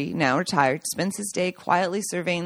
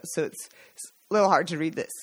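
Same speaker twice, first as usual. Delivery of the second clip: choked up; faster than usual. Real, not acted. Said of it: "getting choked up".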